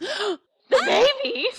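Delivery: high, squealed disbelief; mock shock. Fan reacting to the baby announcement with a friend, mock shock. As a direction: act scandalized, pitch shooting way up. Gasps, feigning shock at plot twist.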